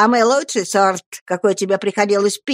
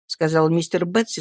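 ordinary delivery

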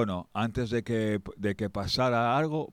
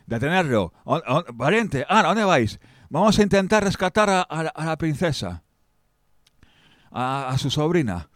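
high, loud commanding call; rally them to the rescue; loud orders; 6 semitones higher, 6 dB louder than usual. Acted. King calls out loud orders to rescue his niece.

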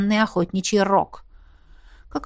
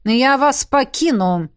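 slow, raised, curt announcement; barked; 5 semitones higher, 4 dB louder, slower than usual. A narrator's reading. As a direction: bark it as you leave. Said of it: "на бегу рявкнул Весауран". (рявкнуть на ходу)

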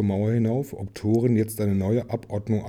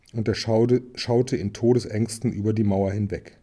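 ordinary delivery, nothing marked

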